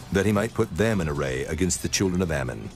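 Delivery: ordinary delivery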